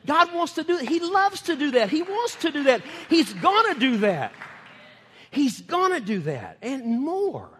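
high, loud preaching build. Real, not acted. Preacher rallying the congregation with rising fervour. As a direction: drive it home to the congregation, slowing at the end.

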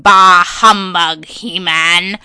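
slow, loud cartoon villain; drawn-out, booming villain voice; Skeletor. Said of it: "I could imagine him being like".

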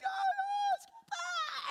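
very high, loud imitated wailing; drawn-out mock screaming cry; hysterical baby wail. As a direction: bawl like a hungry baby. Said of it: "he starts crying, like, hysterical".

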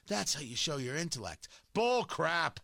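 normal talking voice

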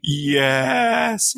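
put-on high little-boy whimpered yes; mock toddler yes. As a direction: answer like a sniffly toddler. Toddler answering through tears.

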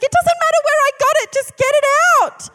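very high, loud, fast shout; frantic shout. Retold or imitated. Sleepwalking husband orders his wife, very high fast shout. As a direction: rush it out in desperate panic.

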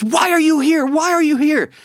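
high, insistent mimicked woman's voice; Italian lady. Italian lady questions the pilgrimage leader, high urgent imitation. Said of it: "I can't do the Italian accent".

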